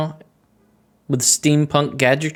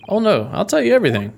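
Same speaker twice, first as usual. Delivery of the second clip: high, fast, scared blurting; hammed-up frightened surrender; mock panic; 8 semitones higher, faster than usual. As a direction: cave in hurriedly, voice pitched up. Threatened captive gives in at once.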